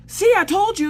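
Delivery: high, loud squealed told-you-so; mock-frantic insistence; retold vindication. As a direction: crow it at him in a squeal. Acted vindicated reply.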